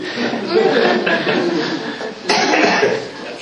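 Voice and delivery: bursts out laughing; short hearty laugh. Real, not acted. Joke about treasurers' answer.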